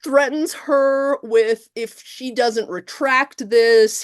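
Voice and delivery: high, slow incredulous telling; slow outraged emphasis. Real, not acted. Telling how Cole threatens Christine, slow and high. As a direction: stretch it out in disbelief.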